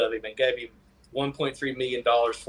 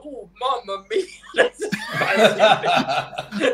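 hammed-up Italian, higher and laughing; Italian accent; 5 semitones higher, 3 dB louder, much slower than usual. Jokes about the Lamborghini film in a hammed-up Italian accent. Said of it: "spicy meatball impression".

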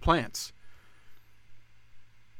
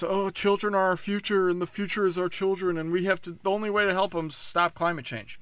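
higher-pitched mocking politician voice; Kamala Harris; 6 semitones higher than usual. Commentator imitating the vice president on children and climate, mocking. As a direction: parrot a politician's earnest speech, pitched up. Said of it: "Kamala Harris".

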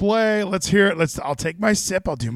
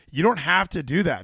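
ordinary talk, nothing marked

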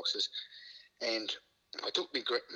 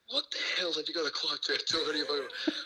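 mock yell; 5 semitones higher, 3 dB louder than usual. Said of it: "Hindmarch just yells out across the room".

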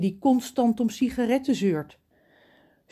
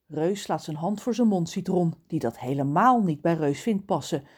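plain reading voice

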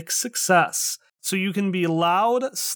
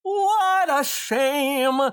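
high, slow, dramatic despair; theatrical lament; 6 semitones higher, much slower than usual. Acted. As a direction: wail it like a diva.

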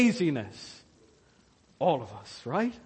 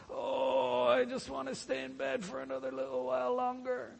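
higher, much softer whining moan; whiny sluggard; 5 semitones higher, 10 dB quieter than usual. Acted. Sluggard answering the wake-up call, high and whiny. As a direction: whine like a lazy man dragged from bed.

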